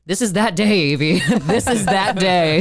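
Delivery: lightly hammed-up movie line; playfully imitated declaration. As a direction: act out a rallying reply, stretching it the second time. Acting out Jonathan's reply to Evie.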